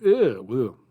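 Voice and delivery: loud mock disgust, fans recoiling. [name] imitating fans reacting to the ending with loud disgust. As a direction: sneer it out loudly like a repulsed fan. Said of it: "universally kind of".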